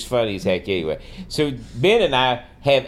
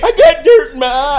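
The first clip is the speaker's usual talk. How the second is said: loud, high-pitched hammed-up sobbing voice; mock crying; 11 dB louder than usual. Friend jokingly excusing movie tears, mock crying. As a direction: fake a tearful excuse through laughter.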